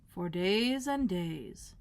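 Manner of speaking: very slow and drawn out; slow drawl. Acted. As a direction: draw out the days. Footman drawl.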